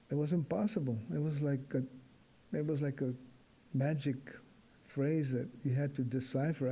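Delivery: normal talking voice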